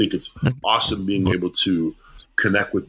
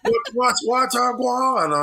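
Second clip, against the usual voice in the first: high, hammed-up fake Jamaican; mock an American doing a watered-down Jamaican accent; bad Jamaican accent; slower than usual. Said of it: "Americans come on and they're like".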